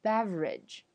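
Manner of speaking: Beverage as BEV-rij; syllable dropped. Shown as a demonstration. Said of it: "省略了中间那个v".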